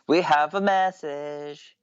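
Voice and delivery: stretched, sustained message call; drawn-out announcement. Real, not acted. Co-host announces a message, drawn-out call. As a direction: draw out each word, holding the last.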